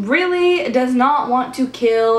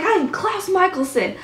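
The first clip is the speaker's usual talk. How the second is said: higher, put-on grand villain voice; hammed-up menacing boast; Klaus's boast; 7 semitones higher than usual. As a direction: declaim it like a proud ancient vampire. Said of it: "He's like".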